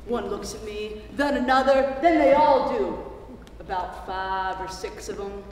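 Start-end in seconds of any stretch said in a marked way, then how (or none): none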